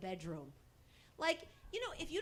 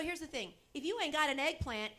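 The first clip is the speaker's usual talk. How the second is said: usual speaking voice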